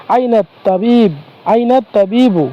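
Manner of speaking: slow teacher model of আইনা তাবিবু; slow careful demo. Shown as a demonstration. Teacher slowly models আইনা তাবিবু for students, careful and drawn out. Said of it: "এটা যদি বলতে হয় তাহলে আমরা বলতে পারি".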